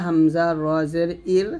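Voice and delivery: ইর with a light, thin final R. Shown as a demonstration. Teacher shows students the light R in ইর, thin Ra. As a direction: keep the R of ইর light. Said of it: "জের আসলে পাতলা".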